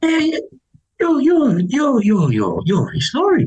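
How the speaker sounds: high, stammering imitation of a fan; retold starstruck burst; excited recognition. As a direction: stumble over the words as recognition hits. Retells stranger excitedly recognising him.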